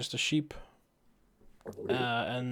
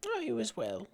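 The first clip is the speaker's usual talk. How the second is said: put-on frail old lady; 9 semitones higher, 5 dB quieter than usual. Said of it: "small, frail old lady".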